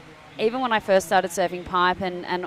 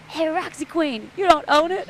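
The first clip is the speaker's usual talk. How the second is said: uncle telling her off; 9 semitones higher than usual. Retold or imitated. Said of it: "and he's like".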